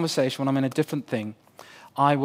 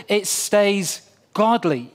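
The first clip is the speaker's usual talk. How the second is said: ordinary talk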